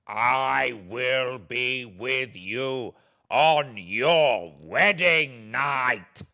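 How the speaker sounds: a hint of the creature, slow and ominous; lightly voiced creature threat. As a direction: replay the threat slowly in his head.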